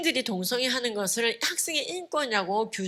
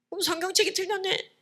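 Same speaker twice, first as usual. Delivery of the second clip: higher-pitched mock retort from a kid; mock kids' retort; 10 semitones higher than usual. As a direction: lightly voice a smug child saying it. Imitating gaslit kids' retort. (아이 말투를 흉내 낸 높은 목소리)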